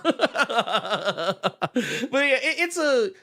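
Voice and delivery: real laughter breaking into the words; talking while cracking up; laughing. Real, not acted. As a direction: try to carry on through a high-pitched laugh. Cracking up at the echoed catchphrase.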